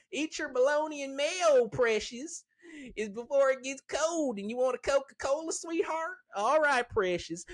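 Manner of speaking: hammed-up high-pitched mama voice. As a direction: play a doting trailer-park mom serving lunch.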